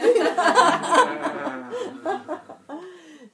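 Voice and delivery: big burst of real laughter; hearty loud laughter. Real, not acted. Teller laughs at story.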